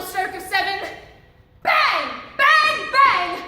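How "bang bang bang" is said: bang, bang, bang, punched out loud; loud gunshot bangs. Acted. Narrator acting out the gunshots, loud bangs. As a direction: shout the shots like a pistol firing.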